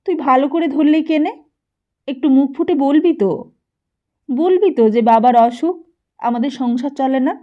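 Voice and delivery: mother pushing her son to plead harder; mother scolding. A narrator's reading.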